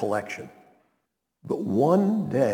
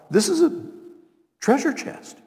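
amazed; 11 semitones higher than usual. Acted. Astounded finder's amazement.

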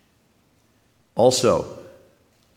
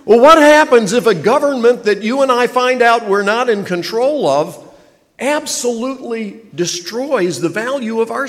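much higher and louder, driving the warning home; full-force preaching; 10 semitones higher, 8 dB louder than usual. Acted. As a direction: preach it hard, sounding the alarm.

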